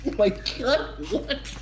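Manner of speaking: high, slow, laughing disbelief; mock shock. Friend retelling the villain's reaction, high and laughing. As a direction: gasp it out through a laugh. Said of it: "It's like".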